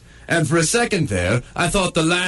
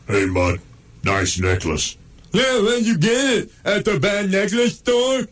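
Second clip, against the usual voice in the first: taunting dog voices; 6 semitones higher than usual. Acted. Neighbor dogs mock the coned dog's necklace, taunting. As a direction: tease the coned dog like bullies.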